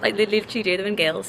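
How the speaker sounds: ordinary delivery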